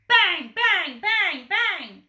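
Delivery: loud, high bang bang; loud knocking. A narrator's reading. Postman banging on the door, voiced loudly. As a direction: pound it out.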